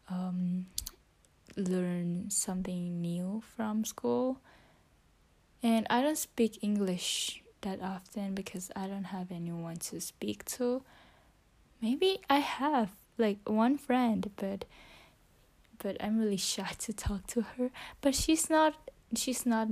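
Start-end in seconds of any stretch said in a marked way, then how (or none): 1.6-4.4 whispering. Real, not acted. Whispering her English practice into the phone. 15.8-17.7 whispering. Real, not acted. Whispering her English practice into the phone.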